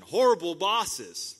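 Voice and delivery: loud, slow Horrible bosses; drawn-out loud stress on Horrible; emphatic call. Real, not acted. Preacher calling out to the congregation, loud and slow. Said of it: "repeated for emphasis".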